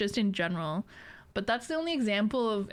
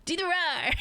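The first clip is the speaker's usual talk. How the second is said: put-on raised voice of a pestering little kid; kid from Shrek; 6 semitones higher than usual. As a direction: beg like a kid demanding a trick. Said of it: "the little fucking kid from Shrek".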